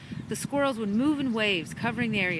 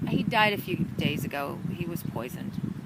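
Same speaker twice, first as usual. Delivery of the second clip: somber admission; 4 semitones lower than usual. A narrator's reading. Sad news after a sigh.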